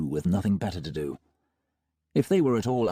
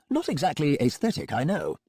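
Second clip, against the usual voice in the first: higher, smooth, faster doctor; breezy, untroubled villain doctor; Melmoth voice; 7 semitones higher than usual. A narrator's reading. Melmoth admits.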